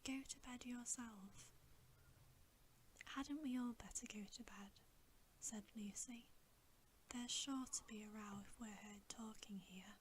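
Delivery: ordinary delivery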